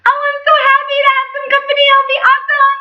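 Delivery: very high, fast chirpy dwarf; high fast cheerful squeak; Happy voice. Acted. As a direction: bubble it out fast and gleeful. Happy's line, named after.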